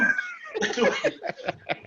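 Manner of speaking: a high, squealing burst of laughter; high giggle after the punchline. Real, not acted. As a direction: laugh hard at his own joke.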